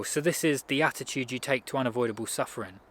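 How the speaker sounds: ordinary talk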